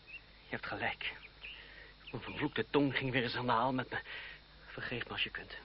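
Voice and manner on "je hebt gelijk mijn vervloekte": soft, slow whispered admission; ashamed whisper. Acted. André conceding to his cousin, slow whisper. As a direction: breathe it out in shame.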